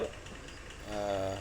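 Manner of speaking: ordinary talk, nothing marked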